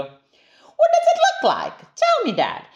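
put-on squeaky eager child; little monster begging her dad; high child voice.

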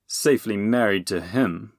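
ordinary delivery

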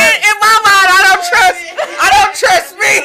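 laughing. Real, not acted. Retelling embarrassing massage moment.

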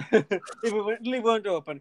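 normal talking voice

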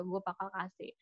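hushed mock offer; hushed quote. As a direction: say it quietly as if sharing a secret. Retelling a hypothetical offer, hushed.

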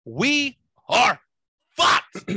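loud, high, word by word; emphatic verdict. Real, not acted. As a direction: punch each word out, loudly. Blunt verdict closing the news rant.

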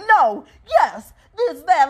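imitating a curt little brother; mock aggressive one-word answers. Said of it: "he be so aggressive with it".

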